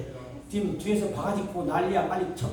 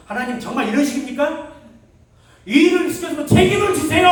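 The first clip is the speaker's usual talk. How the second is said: much higher and louder protest at God; retold angry prayer; 9 semitones higher, 11 dB louder than usual. Young pastor on the mountain protesting to God, angry shout. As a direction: demand it like a wronged worker.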